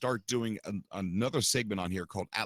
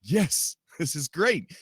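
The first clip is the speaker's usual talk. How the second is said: retold excited yes, higher and louder; excited reaction; 5 semitones higher, 5 dB louder, slower than usual. Host retelling his reaction to listeners' paranormal chat, excited. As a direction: recall your delight with a raised, emphatic cheer. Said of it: "And I'm like".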